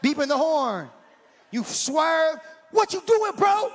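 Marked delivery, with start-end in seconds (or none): 2.7-3.7 high, loud angry yell at a driver; furious shout of a cut-off driver; road rage yell. Acted. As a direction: blast it like someone just cut you off.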